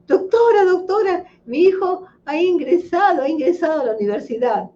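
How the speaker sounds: high, excited father's voice, fast at the end; happy father. Retold or imitated. Father telling the doctor his son got into university, high and overjoyed. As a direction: burst out the good news like a thrilled dad. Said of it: "Contento y feliz el papá".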